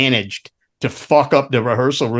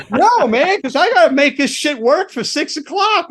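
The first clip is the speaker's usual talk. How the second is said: high-pitched acted pleading; hammed-up frantic objection; panicked protest; 13 semitones higher than usual. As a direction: protest it urgently, voice way up. Acted protest to a rampaging bandmate.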